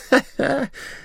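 high tipsy giggle; drunken laugh. Acted. Tipsy Maruf after revealing the ring, drunken laugh.

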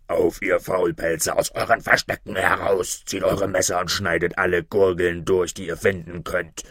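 robber chief barking at his hidden men, in a mildly deepened voice; angry robber captain. Acted. Captain shouting at hiding robbers, mildly angry and lightly voiced. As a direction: call your cowering men out, furious but only lightly voiced. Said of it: "Er klatschte in die Hände und rief".

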